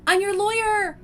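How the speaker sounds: high, loud delighted cry; excited realization. Real, not acted. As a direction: burst out with the discovery. Realizes the plan, gasps.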